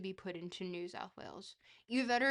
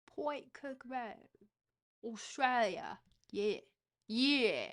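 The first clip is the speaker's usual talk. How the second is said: drawn-out put-on Australian accent; practising Australian accent; 3 semitones higher, 3 dB louder, slower than usual. Shown as a demonstration. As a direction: try out an Australian accent on place names. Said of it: "need to work on my accents".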